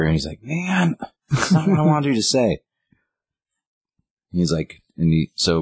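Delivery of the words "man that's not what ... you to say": much higher, whiny exasperation; exasperated groan. Retold or imitated. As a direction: throw up your hands, pitch way up. Grifter exasperated at Luke.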